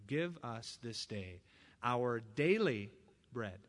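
slow, hushed prayer, each word weighed; reverent prayer. Shown as a demonstration. Pastor praying the right way, slow and reverent. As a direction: pray it slowly and humbly. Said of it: "One day at a time".

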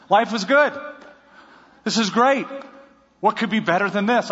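preacher lightly playing his younger, smug single self; mock carefree bachelor. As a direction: ham up the contented single guy.

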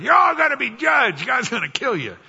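high, loud mock doomsday cry; ranting prophet. Preacher playing Jonah warning Nineveh, mock shouting. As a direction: yell it like a wild-eyed street prophet. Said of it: "As he's walking around saying".

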